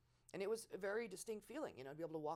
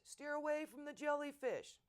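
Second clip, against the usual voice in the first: shouted instruction; 10 semitones higher, 5 dB louder, slower than usual. Retold or imitated. Guy's reply under the parachute.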